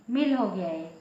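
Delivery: normal talking voice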